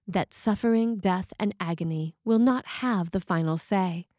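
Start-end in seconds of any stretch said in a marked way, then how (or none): none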